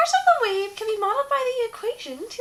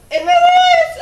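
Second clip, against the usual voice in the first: shouting; 9 semitones higher, 13 dB louder, slower than usual. Acted. Dramatic reveal in a math skit.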